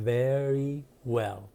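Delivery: very with a clear lip-bitten v, then a rounded w in well; careful v and w in very well. Shown as a demonstration. Teacher showing the class how to say very well. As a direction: say very well slowly, teeth on lip for the v. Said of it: "وی و دبلیو تون رو".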